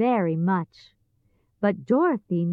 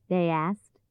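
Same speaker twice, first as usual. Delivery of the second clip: usual speaking voice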